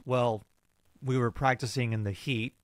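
normal talking voice

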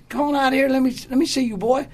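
high, eager calling-out; retold eager call. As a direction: act out a friend calling someone out of his room. Friend calls him out of room.

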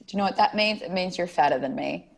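ordinary delivery

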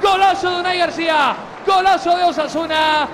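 shouted goal call, loud and high; goal commentary shout. Real, not acted. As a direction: yell the goal like a stadium commentator.

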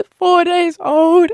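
high, slow, drawn-out mock wail; tearful wail. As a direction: sob it out, hammed-up. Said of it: "I wasn't just crying, I was ugly crying".

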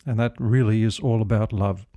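usual speaking voice

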